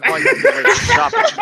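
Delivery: laughing while telling him off. Real, not acted. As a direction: squeal it through laughter. Laughing protest at friend.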